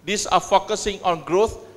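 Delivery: normal talking voice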